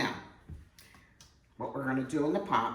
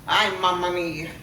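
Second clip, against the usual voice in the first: loud, happy Italian exclamation; delighted exclaim; 3 semitones higher, 9 dB louder than usual. Real, not acted. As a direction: cry out in delight. Delighted by the aroma.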